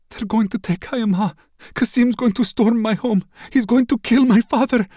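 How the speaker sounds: high, loud, rising panic; panicked. Acted. Princess panics about her father, high and loud. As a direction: let the fear spill over. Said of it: "Her voice is panicked".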